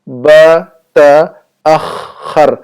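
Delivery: بتأخر very slowly, split ba-ta-akh-khar; drawn-out teaching of بتأخر; syllable-by-syllable demo. Shown as a demonstration. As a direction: break بتأخر into separate syllables with pauses. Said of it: "ایسا کہیں گے".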